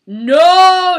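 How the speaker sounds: long, loud, high-pitched cry; mock dramatic shout. A narrator's reading. Sherlock cries out as the skull is threatened, dramatic shout. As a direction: belt it out and hold the vowel.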